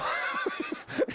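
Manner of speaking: high breathy burst of laughter; laughing. Real, not acted. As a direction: laugh at the punchline of your own story. Laughs at his own story.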